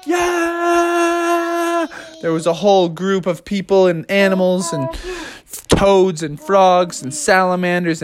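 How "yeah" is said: high, long-held crowd cheer; mock crowd roar, held back in volume. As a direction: draw out a high 'yeah' like a distant crowd. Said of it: "everyone went".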